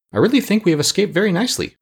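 a touch of self-satisfied pride; proud tone. Acted. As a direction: sound proud of the escape. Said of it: "in a tone of pride".

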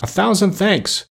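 high, slow grateful cry; joyful thanks. Acted. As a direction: pour out heartfelt thanks. Prince thanks fairy joyfully.

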